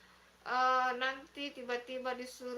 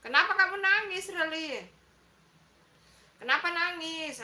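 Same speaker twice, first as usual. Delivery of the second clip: higher, louder, quick worried question; alarmed concern; 5 semitones higher, 6 dB louder than usual. Real, not acted. Host asks a crying viewer why, alarmed and quick. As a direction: rush to ask what's wrong.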